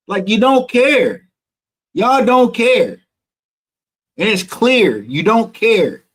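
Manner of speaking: fed-up accusation, drawn out; indignant. Real, not acted. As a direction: lay it down hard on "don't care". Angry at rude nurses.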